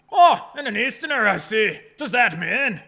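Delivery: high, loud bad Scottish accent; pompous governor in put-on Scottish; Barry Caden. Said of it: "I'm the governor, Barry Caden".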